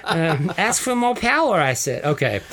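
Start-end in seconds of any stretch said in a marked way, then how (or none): 0.6-1.6 high, pushy wife voice. Retold or imitated. Herodias pushing her husband, high put-on voice. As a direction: needle him upward in a high voice. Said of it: "You do that so well".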